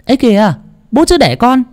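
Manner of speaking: high, piercing mocking call. A narrator's reading. Scheming daughter-in-law mocks the old man: piercing high taunt. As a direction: cut in sharp and shrill like a scheming woman. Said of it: "có giọng nói lanh lảnh vang lên".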